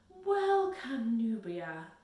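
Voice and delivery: beam out a big hearty greeting. A narrator's reading. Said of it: "beamed the cook".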